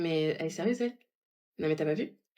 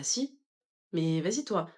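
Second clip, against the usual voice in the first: normal talking voice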